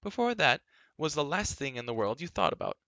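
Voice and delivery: high, emphatic jab; scornful. A narrator's reading. Girl arguing back at Taryn, scornful. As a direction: throw it at him with indignant swoops.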